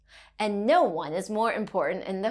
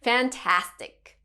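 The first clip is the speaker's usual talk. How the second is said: ordinary talk, nothing marked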